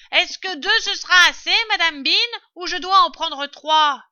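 very loud, high question; calling up. A narrator's reading. Servant asking madame Bean about the jars, calling up. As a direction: shout it up the stairs.

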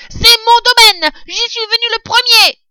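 high, furious rat yell; vociferating rat. A narrator's reading. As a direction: refuse loudly in a piercing little voice. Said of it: "vociférait le rat". (rat qui vocifère, aigu et furieux)

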